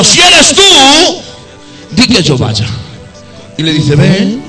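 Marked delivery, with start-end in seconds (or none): 0.0-1.2 very slow, high Si eres tú; Peter pleading. Retold or imitated. Peter calling out to Jesus on the water, slow and high. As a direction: stretch out Si eres tú, pitched up. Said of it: "Cuando dice Pedro".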